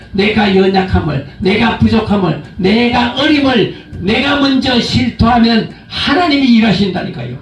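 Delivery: normal talking voice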